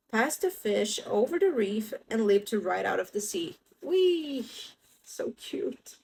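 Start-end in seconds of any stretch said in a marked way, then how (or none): 3.8-4.5 excited whee. Acted. Penguin leaps out. 5.0-6.0 whispering. Real, not acted. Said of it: "whispered aside".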